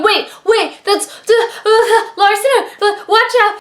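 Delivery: retold freak-out, tripping over the words. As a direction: act out a flustered, panicky warning. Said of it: "I freaked out. I was just going".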